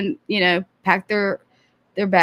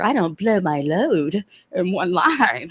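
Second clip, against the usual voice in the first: mock villain; slower than usual. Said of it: "you're reminding me of somebody".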